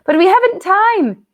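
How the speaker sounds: put-on high, urgent girl's voice; Susan, anxious. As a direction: push it up high and worried. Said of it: "said Susan".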